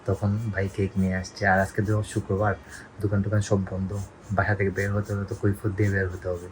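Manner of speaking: low, very soft confiding murmur; hushed secretive voice. Real, not acted. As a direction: keep it down so Dad won't overhear. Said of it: "আমি আস্তে আস্তে কথা বলতেছি". (চাপা গলায়, আস্তে আস্তে)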